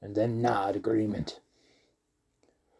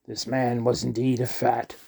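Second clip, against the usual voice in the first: ordinary delivery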